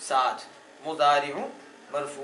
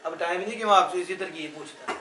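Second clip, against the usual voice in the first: ordinary talk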